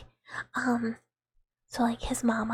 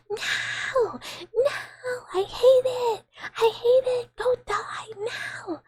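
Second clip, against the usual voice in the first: much higher, louder, wailing cat protest; screaming cat voice; 12 semitones higher, 4 dB louder, slower than usual. Retold or imitated. Streamer voicing her pinned cat as a much higher, wailing shriek. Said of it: "Mister Meow Meow is like".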